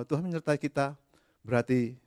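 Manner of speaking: ordinary talk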